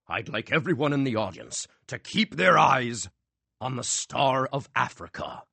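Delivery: stage magician. A narrator's reading. Magician addressing the theater audience, showy. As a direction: command the audience's eyes.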